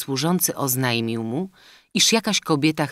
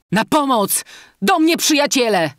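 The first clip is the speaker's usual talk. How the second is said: desperate scream, much higher and louder; cry for help; 12 semitones higher, 7 dB louder than usual. A narrator's reading. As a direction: shout for the sleeping friends in panic. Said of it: "Bega krzyknął".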